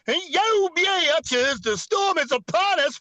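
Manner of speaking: high, hyped-up rally MC; rally opener hype. Pretend. Opening the Trump rally for the crowd, high hype MC. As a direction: work the crowd like a warm-up act. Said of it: "let the rhino fly out to Arizona".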